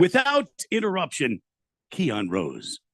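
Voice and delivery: show-title announcement. Shown as a demonstration. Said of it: "I'm sorry, I said it wrong".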